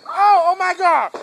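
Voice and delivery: loud, drawn-out scared cry; scared shout. Real, not acted. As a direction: yell it out, frightened. Firework blows up, he's scared.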